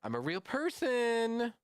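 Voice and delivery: high-pitched pretend bot; high mock voice. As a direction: squeak out a fake human claim. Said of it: "They could have said something about".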